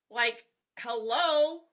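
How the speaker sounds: slow, loud drawn-out "hello?" as if it were obvious; sarcastic hello. Real, not acted. Telling viewers about the parole notice, drawn-out ironic hello. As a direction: stretch it out, wide-eyed and ironic.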